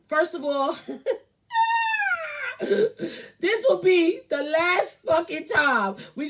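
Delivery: laughing through the words; cracking up mid-rant. Real, not acted. Laughing while starting a rant.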